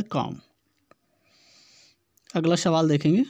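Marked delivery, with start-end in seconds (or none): 0.0-0.4 कॉम्ब said "kom", closing on M; silent B. Shown as a demonstration. Teacher gives the answer's pronunciation, कॉम्ब with silent B. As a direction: drop the B at the end of कॉम्ब. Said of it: "इसका उच्चारण होता है".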